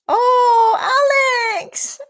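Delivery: a sudden high, loud cry of delight; excited cry. Real, not acted. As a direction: burst out with joy at the gift. Cries out on seeing the donation.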